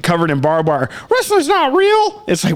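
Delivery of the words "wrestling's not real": high, slow mocking whine of a naysayer; mocking imitation. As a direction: mimic a dismissive sceptic in a high voice. Mimics people who dismiss wrestling.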